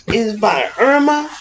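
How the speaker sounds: ordinary talk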